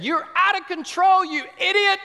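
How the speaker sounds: high, loud furious scolding; enraged teacher shouting down a child; teacher yelling. Retold or imitated. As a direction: scream at the boy like an enraged teacher.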